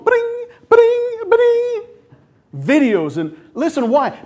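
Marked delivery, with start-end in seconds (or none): 0.0-1.8 sung high phone ping, much higher; phone chime imitation. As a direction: chirp it like a phone notification. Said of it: "You hear the phone".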